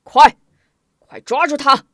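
commanding shout. Acted. A general orders his troops to seize the hero, frantic shout. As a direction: scream the order to seize him. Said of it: "立即号令".

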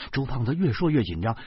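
usual speaking voice

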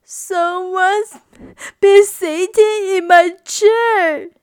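put-on high, loud baby bear wail. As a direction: wail it like a small bear about to cry. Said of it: "wailed Baby Bear".

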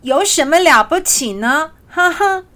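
high, loud little hedgehog voice; cheeky hedgehog. Acted. Hedgehog answers the boastful giraffe, high cheeky retort. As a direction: pipe back proudly with a little laugh.

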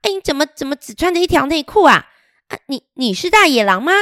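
high, startled little mother voice; a nervous mama hedgehog questioning a stranger. A narrator's reading. Hedgehog mother questions the wolf, high and startled. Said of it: "刺猬妈妈哦，就跑出来说".

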